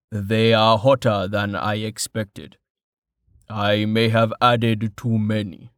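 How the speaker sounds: slow, a hint of a big calm man; measured and unhurried; lightly voiced gentle giant; Tallheart's voice. Tallheart speaks.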